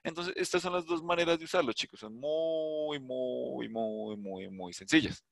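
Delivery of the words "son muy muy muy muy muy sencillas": soft, long-stretched "muy" chained over and over; drawn-out emphasis. Real, not acted. Teacher reassuring students it is simple, softly drawn-out "muy".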